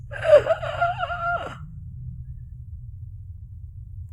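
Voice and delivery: huge high theatrical gasp; dramatic gasp. Pretend. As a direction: gasp like something terrible is coming.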